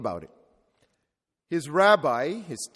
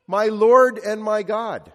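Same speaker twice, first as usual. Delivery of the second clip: awed confession; 4 semitones higher, 7 dB louder, slower than usual. Acted. Thomas recognizes the risen Jesus.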